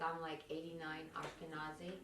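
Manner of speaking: normal talking voice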